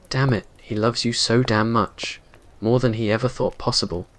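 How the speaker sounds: ordinary delivery, nothing marked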